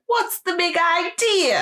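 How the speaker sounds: very high-pitched film diva impression; Lena. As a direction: put on a slow, squeaky-high starlet accent. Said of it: "Except she's quite high-pitched".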